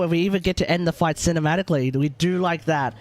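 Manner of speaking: usual speaking voice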